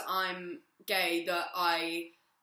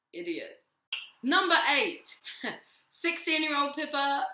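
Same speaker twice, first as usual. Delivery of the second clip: ordinary talk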